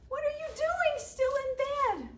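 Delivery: high, loud, astonished shout; children calling out. A narrator's reading. Children finding the chief still in bed, calling out. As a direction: holler it like surprised kids.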